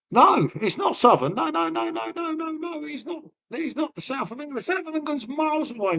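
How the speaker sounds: high-pitched, insistent protest; indignant denial. Real, not acted. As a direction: protest it high, the no's tumbling out. Rejecting being called southern.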